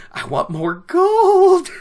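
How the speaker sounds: high, drawn-out cartoon greed; mock greedy. Ferryman eyes Lan's gold, hammed-up greedy cry. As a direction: ham up the gold lust, slow and squealed into a laugh.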